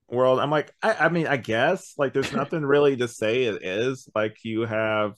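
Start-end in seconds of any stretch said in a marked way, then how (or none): none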